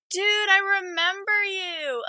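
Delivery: high, loud happy greeting; excited shout. Real, not acted. Greeting a returning viewer.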